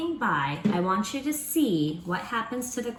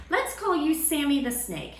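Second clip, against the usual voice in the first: ordinary talk, nothing marked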